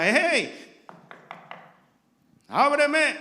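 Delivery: loud pleading shout from outside a door; calling out. Acted. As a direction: call out to be let in.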